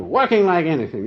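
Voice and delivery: usual speaking voice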